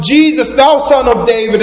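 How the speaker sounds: loud, high cry for attention; pleading cry, projected loudly. Acted. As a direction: call out over a crowd, high and loud. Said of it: "He lifts his voice even louder".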